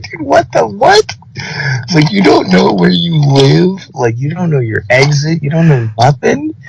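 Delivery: high, incredulous, drawn-out quoted rant; exasperated mock rant. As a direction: squeak out disbelief at her cluelessness. Exasperated retelling to friend.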